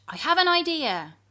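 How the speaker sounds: high, loud eager announcement; excited Jack Flash; bright idea. Acted. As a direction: burst out with the idea.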